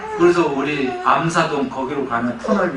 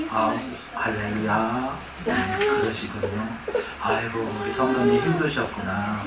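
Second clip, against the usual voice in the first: overly kind pastor; 5 semitones lower, 5 dB quieter than usual. Retold or imitated. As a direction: coo at the congregation like a too-nice pastor. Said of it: "항상 친절한 목사였어요". (지나치게 친절한 목사 흉내, 부드럽고 느리게)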